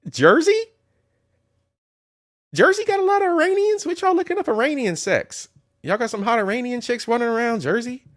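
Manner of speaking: high-pitched, rapid-fire disbelief; incredulous. Real, not acted. Calling out Jersey over search results, incredulous. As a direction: shoot your voice way up, baffled.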